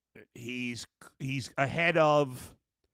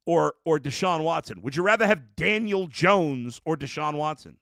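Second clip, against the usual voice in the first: heated, higher and louder rant about the quarterback; emotional rant; 5 semitones higher, 4 dB louder than usual. Real, not acted. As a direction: let the frustration boil over, pushing up and out. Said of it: "I'm emotional".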